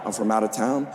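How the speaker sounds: ordinary delivery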